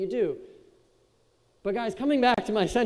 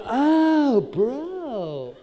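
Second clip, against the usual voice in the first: higher, louder stoked gasp at the wave; awed surfer; 5 semitones higher, 6 dB louder than usual. Retold or imitated. Surfer watching a wave crash, hammed-up awe. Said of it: "they'd be like".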